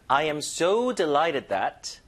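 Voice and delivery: "so" drawn out and emphasized; stress on so. Shown as a demonstration. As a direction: hit "so", then move on to "delighted". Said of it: "so의 강세를 주시는데". ("so"에 강세)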